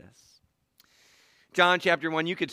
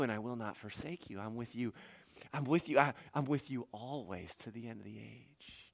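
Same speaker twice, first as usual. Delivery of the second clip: soft, lowered voice of reassurance; quiet promise; 4 semitones lower, 10 dB quieter than usual. Acted. God promising to stay with Jacob, quiet reassurance. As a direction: say it close by, gently.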